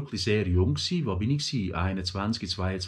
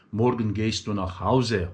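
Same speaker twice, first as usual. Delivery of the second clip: standard German; 3 semitones lower than usual. Shown as a demonstration. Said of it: "auf Hochdeutsch".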